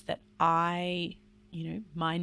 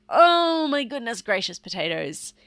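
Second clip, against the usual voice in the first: loud silly phrase; 6 semitones higher, 9 dB louder than usual. Shown as a demonstration. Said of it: "as loud as you can".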